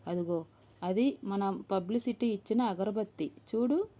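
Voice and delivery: ordinary delivery, nothing marked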